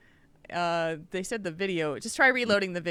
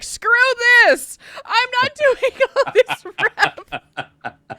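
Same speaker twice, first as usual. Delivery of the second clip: laughing exasperated outburst, high and slow; fed-up outburst; 14 semitones higher, 9 dB louder, slower than usual. Retold or imitated. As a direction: blurt it out in laughing frustration. Fed up with grinding rep.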